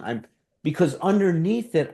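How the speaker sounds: normal talking voice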